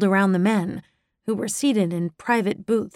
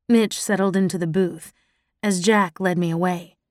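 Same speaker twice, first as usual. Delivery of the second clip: ordinary delivery, nothing marked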